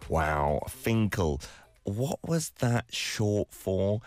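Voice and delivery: normal talking voice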